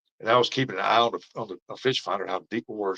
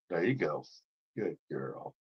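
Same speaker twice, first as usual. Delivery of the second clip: much softer, low and gentle praise for the dog; praising the dog; 4 semitones lower, 9 dB quieter, slower than usual. Real, not acted. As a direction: soothe the dog once she settles. Praising the dog once calm.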